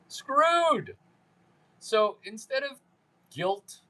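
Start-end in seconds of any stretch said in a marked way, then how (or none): 0.1-1.0 word yelped much higher; high mock shriek. Shown as a demonstration. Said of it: "he's screwed".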